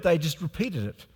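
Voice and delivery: normal talking voice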